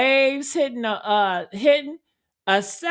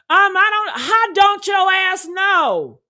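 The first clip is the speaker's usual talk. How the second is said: exasperated outburst; 7 semitones higher, 4 dB louder than usual. Real, not acted. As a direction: yell your frustration at him. Exasperated at caller's vague answer.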